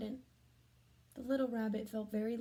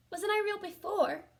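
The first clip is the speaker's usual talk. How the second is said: little rabbit voice; 9 semitones higher, 4 dB louder than usual. Acted. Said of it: "asked the little rabbit".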